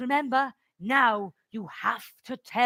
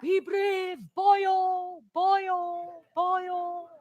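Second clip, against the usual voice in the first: high, sustained chant, fading; echoing memory voice; 6 semitones higher, 3 dB quieter, much slower than usual. Acted. Granddad's words echoing in Sam's head, fading high chant. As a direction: repeat it like a fading echo.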